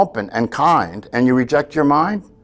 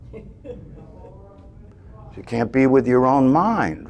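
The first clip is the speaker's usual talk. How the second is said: normal talking voice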